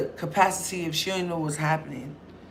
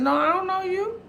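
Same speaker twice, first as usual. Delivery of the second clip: high incredulous; 11 semitones higher than usual. Retold or imitated. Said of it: "My cousin said".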